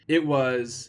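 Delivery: plain reading voice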